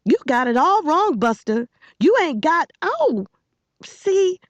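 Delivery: high, heated rebuke; outraged scolding. A narrator's reading. Girl setting the boy straight as she kicks him, outraged. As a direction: tell him off at the top of your range.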